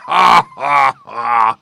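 huge, booming "ha, ha, ha"; roared laugh. Acted. Lion scoffing at the gnat, roaring laugh. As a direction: roar out three big separate laughs. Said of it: "roared the lion".

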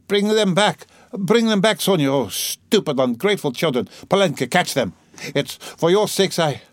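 high, urgent cries after runaways; frantic calling out. Acted. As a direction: call desperately after fleeing children. Mother shouting after fleeing children.

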